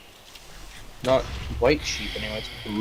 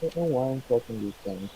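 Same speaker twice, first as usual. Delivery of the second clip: muffled, as if underwater; 2 semitones higher, 3 dB quieter, faster than usual. Real, not acted. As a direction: voice coming through water. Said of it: "You're muffled again".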